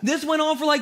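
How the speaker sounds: normal talking voice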